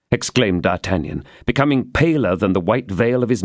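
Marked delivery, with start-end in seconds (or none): none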